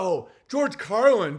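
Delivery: drawn-out, fawning "Oh, George Carlin" in a put-on voice; fawning mock shill; mock fan gushing. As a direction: slowly swoon over "George Carlin" like a fanboy. Mocking shills who cite Carlin.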